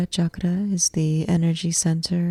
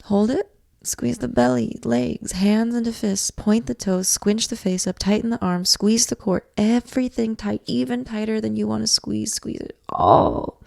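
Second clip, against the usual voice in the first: higher-pitched, tightly held coaching; urgent tensing cue; 5 semitones higher than usual. Shown as a demonstration. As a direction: urge them on through the squeeze, no let-up. Coaching a held breath and full squeeze.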